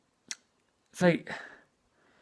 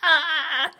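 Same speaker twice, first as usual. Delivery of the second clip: high mock scream of pain; 13 semitones higher, 3 dB louder than usual. Pregnant mother when her water breaks, mock scream. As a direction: let out a short high yelp. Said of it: "she's like".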